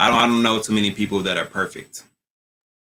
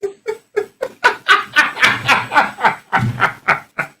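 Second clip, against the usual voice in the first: high, helpless howl of a laugh; howling laughter; 4 dB louder than usual. Real, not acted. Host cracking up at the look Vern gives him, howling laughter. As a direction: laugh till the tears come. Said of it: "Oh, I'm in tears".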